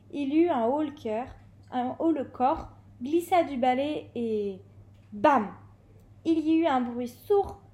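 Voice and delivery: ordinary delivery, nothing marked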